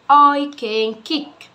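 very slow, each word apart; slow classroom drill; slow teaching read. Shown as a demonstration. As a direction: drill it slowly for a child. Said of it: "ادي انا كررتها مرتين".

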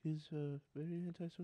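soft antisocial character voice; Wilhelm. Shown as a demonstration. Player describing a character in that character's quiet voice. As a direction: keep it small and withdrawn. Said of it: "Wilhelm talks like this".

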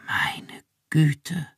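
ganz tonloses, gehauchtes Flüstern. Acted. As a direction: staunend flüstern, fast ohne Stimme. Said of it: "flüsterte Iran". (erstauntes Flüstern)

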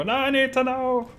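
imitating a desperate fan, ending in a yelp; mock desperate viewer. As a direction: play a frantic player begging for a date. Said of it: "shout on the forum".

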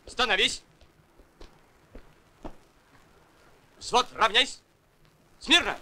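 drill commands. Acted. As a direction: bark orders at the platoon in formation. Platoon commander giving drill commands. (строевые команды)

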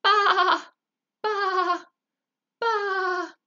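loud, high sheep bleating; top of his lungs. Acted. Sheep bleating to the badger at full volume. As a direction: belt the baa as loud as you can. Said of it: "sang Samuel at the top of his lungs".

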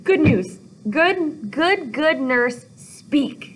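high, emphatic, word-by-word begging; pleading. Acted. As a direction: coax each word out desperately. Acting Juliet begging the nurse.

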